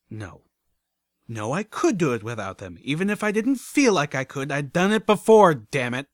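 rising to high, loud insistence; emphatic self-insistence. Acted. Narrator overrules own doubt about the repair, vehemently insisting.

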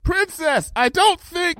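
high-pitched objection called out; shouted protest. A narrator's reading. Pupil protests from the tower to the princess, shouting. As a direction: start to object at a yell, then get cut off.

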